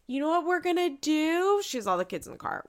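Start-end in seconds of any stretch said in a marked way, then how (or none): 0.1-1.6 high, bright mock-cheery quote; hammed-up mom announcing a plan; imitating Mackenzie. Recapping the show, mimicking a mom in the car, high and loud. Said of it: "she's like".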